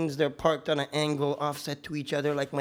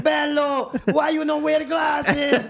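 higher, louder mock Italian foreman; imitating foreman Mario; 9 semitones higher, 6 dB louder, slower than usual. Foreman scolding rookie for no glasses, higher loud mock. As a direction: holler it like a boss scolding the new guy. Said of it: "And Mario was like".

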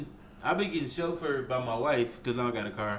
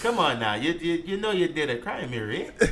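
Canadian police officer parody, laughing; badly faked Canadian accent; 3 semitones higher, 3 dB louder than usual. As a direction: play a polite Canadian cop with a botched accent. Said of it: "you really sound like that is not a Canadian".

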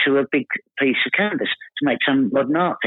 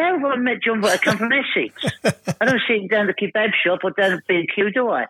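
mock indignant; 7 semitones higher than usual. Indignant Essex retort in story.

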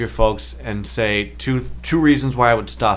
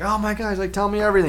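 lightly voiced, excited dad, pitched higher; imitating excited dad; 9 semitones higher, 5 dB quieter, faster than usual. Son quoting his dad's excited reply to the engagement news, higher and excited. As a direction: retell dad's thrilled reaction, voice up high. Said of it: "He was super excited".

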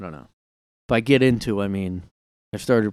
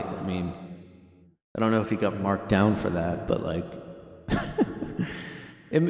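ordinary talk, nothing marked

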